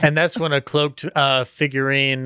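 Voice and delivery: ordinary talk, nothing marked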